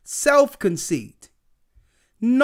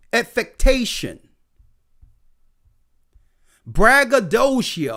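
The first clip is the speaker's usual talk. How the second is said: ordinary talk, nothing marked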